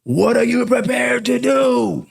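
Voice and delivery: Sean Connery with authority. Retold or imitated. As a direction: bark the line like a commanding mentor. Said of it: "with authority and passion".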